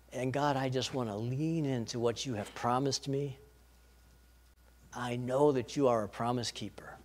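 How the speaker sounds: soft prayer. Pretend. Believer laying scripture before God, soft and tender. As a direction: pray it gently and lovingly. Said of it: "and say".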